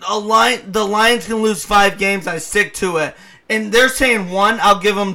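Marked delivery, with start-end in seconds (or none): none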